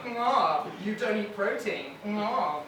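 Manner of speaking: ordinary talk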